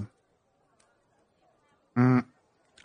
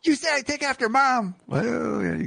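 sassy voice; 14 semitones higher than usual. Acted. Teen sass reply.

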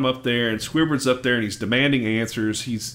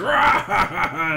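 high ghost-pirate "Rah"; imitated monster roar with laughter in it; mock scary roar; 6 semitones higher than usual. The Dutchman jumps out to scare the kid, mock roar. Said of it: "the Dutchman's like".

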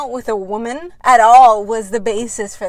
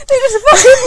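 laughing disbelief; 12 semitones higher, 11 dB louder, faster than usual. Real, not acted.

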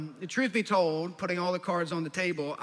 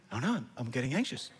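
anxious mumble; 6 semitones lower, 4 dB quieter, faster than usual. Retold or imitated. Anxious reply.

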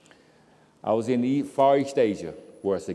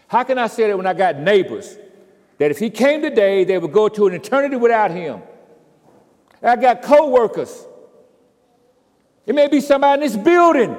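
loud, high, impassioned preaching; full-force preaching; 11 semitones higher, 10 dB louder than usual. Acted. Preacher challenging the church about lost neighbors, loud and impassioned. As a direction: hammer the congregation with the question.

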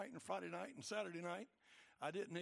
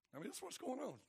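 ordinary talk, nothing marked

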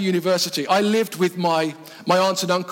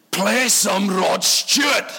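put-on slow, drawn-out drunken demand; drunk old man; much slower than usual. Old guy finally making himself clear to the band, slow drunken voice. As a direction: spell it out like a tipsy old-timer. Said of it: "had one or two too many milkshakes".

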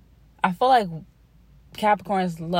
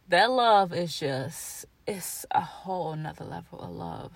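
teary whisper; 2 semitones lower than usual. Real, not acted. Mom tearing up over her baby girl, whispering. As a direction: whisper it, fighting back tears. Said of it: "this shit is making me tear up".

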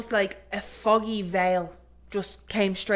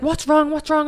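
worried dad; 7 semitones higher, 4 dB louder than usual. Retold or imitated. Dad asks what's wrong.

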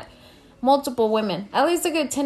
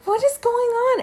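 mock confused boyfriend; 14 semitones higher, 3 dB louder than usual. Boyfriend woken, voiced by teller.

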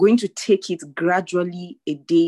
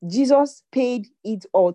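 higher, louder proclamation of Jesus; louder triumphant declaration; rising proclamation; 6 semitones higher, 5 dB louder than usual. Shown as a demonstration. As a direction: lift it up and proclaim it. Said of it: "Tell yourself".

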